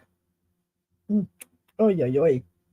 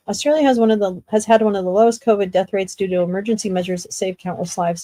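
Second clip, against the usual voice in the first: ordinary delivery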